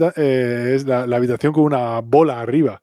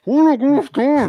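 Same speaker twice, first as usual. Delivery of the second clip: high, slow, muffled mock voice; hammed-up muffled greeting, pitched way up; pretend masked voice; 12 semitones higher than usual. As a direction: play talking through a face mask. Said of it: "podían grabar todos con mascarilla".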